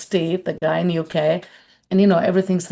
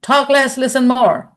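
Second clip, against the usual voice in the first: raised-pitch imitation of an admonishing grandmother; grandmother's maxim; 6 semitones higher than usual. Grandmother to a talkative grandchild, admonishing. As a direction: lay down a grandmother's rule, pitched up. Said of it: "Another story from my grandmother".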